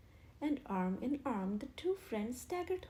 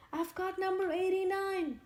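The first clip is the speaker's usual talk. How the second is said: higher, louder desperate shout; 8 semitones higher, 5 dB louder than usual. A narrator's reading. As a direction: wave and yell. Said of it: "he shouted".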